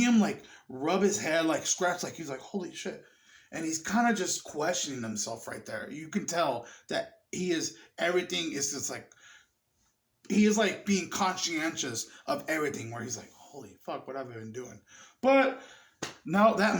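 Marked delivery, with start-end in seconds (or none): 2.4-3.0 very soft, stunned aside; hushed realization. Acted. Man walking away questioning himself, hushed and stunned. 13.3-14.8 low, very soft dismay; hushed self-reproach. Acted. Man becoming conscious of what he did, hushed dismay. Acting Griffin's conscience returning.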